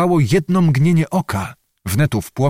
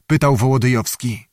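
usual speaking voice

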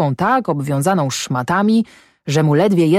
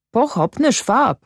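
plain reading voice

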